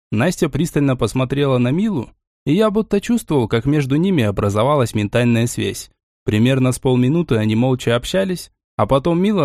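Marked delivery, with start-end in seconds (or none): none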